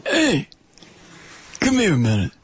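lightly imitated Italian uncle; a hint of Italian mobster; uncle's mafioso Italian accent. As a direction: call out "hey" high in an Italian wiseguy accent. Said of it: "Uncle Victorio was especially good at that".

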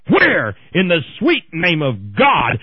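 shouting up high, slowing at first; screaming. Real, not acted. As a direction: bellow it to the heavens in outrage. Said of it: "you're screaming again".

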